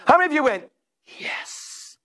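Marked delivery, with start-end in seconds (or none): 1.2-2.0 unvoiced, hushed hissing yes; mock relish. Preacher mocking listeners who'd enjoy the puking, whispered yes. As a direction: whisper it with pretend delight. Mock eager response to gross story.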